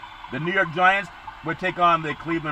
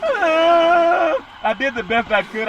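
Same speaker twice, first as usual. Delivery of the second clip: long high drawn-out honk; moose horn impression; 12 semitones higher, 5 dB louder, much slower than usual. Host imitating the Vikings' stadium horn, a long high honk. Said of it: "I'm gonna do my best horn impression".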